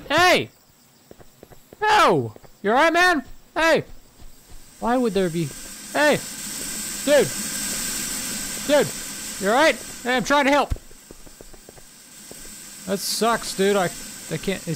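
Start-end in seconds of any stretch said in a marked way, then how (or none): 0.1-3.8 higher, louder panicked calls; alarmed shouting. Real, not acted. Seeing a man collapse. 5.9-10.7 alarmed. Real, not acted. Trying to help dying man.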